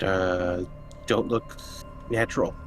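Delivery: ordinary talk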